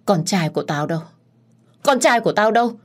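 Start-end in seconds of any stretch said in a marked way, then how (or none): 1.8-2.7 higher, louder insistent asking; confused mother crying out for her son, loud and high; demanding cry. A narrator's reading. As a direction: demand it urgently. Old woman repeats question louder.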